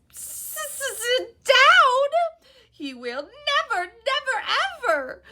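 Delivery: very high, loud, stammering elf sobs; sobbing house elf. A narrator's reading. House elf sobbing at Harry's kindness, very high. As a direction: wail it through noisy tears. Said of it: "the elf burst into tears".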